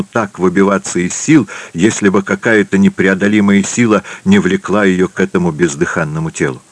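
ordinary delivery, nothing marked

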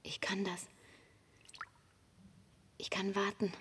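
calm, even first-person narration over music; Ida's inner voice, close and steady; inner monologue. A narrator's reading.

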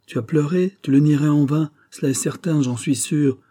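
high, pressing challenge; insistent accusation. Acted. Cousin insists she has been crying.

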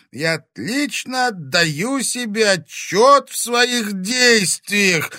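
high, loud, strained defiance; pained shout. A narrator's reading. Wounded Timofey answering the dragon, high pained shout. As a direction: force it out slowly through pain. Раненый Тимофей кричит дракону.

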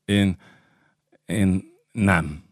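soft, halting flustered refusal; hesitate, embarrassed, between the words. A narrator's reading. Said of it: "Vitéz zavartan lesütötte a szemét". (zavartan, halkan, akadozva)

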